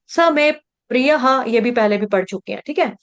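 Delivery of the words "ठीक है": ordinary talk, nothing marked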